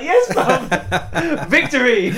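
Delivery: loud, high victory cheer through laughter; triumphant. Retold or imitated. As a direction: crow over a win, laughing. Re-enacting his triumph as cop leaves.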